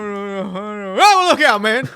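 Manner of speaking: loud amused movie quote; quoting a movie line. Retold or imitated. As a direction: blurt it like the character. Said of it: "The line where he says to Dewey".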